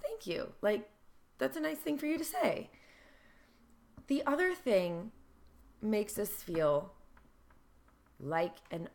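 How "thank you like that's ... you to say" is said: pleased reaction. Retold or imitated. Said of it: "the way we receive that is like".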